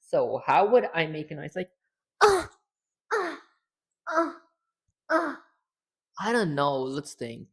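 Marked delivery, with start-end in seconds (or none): 2.2-5.4 short high hurt "uh"; small pained grunts of a hit character; damage moan. Shown as a demonstration. Streamer tests female taking-damage noises, short high moans. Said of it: "some kind of moaning noises here".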